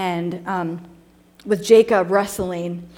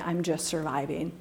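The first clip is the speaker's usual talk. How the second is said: weary admission; 5 semitones lower, 11 dB quieter than usual. Retold or imitated. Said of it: "would honestly say".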